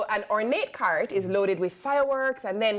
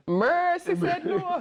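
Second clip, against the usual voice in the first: high mock voice; 10 semitones higher, much slower than usual. Reaction to coffin omen.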